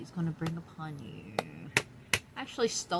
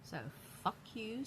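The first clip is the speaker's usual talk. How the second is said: normal talking voice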